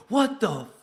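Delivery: high, loud shocked cry; shocked outburst. Retold or imitated. Producer realizing who the caller was, high loud shock. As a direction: blurt it out in disbelief. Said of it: "Then he went".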